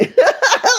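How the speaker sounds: high burst of laughter. Real, not acted. Host amused by a viewer's comment, high laugh.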